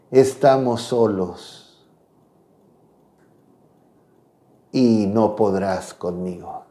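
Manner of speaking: low, slow demonic voice; menacing demon, deep and slow; silky menacing villain. Retold or imitated. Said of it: "Era una voz realmente horrorosa".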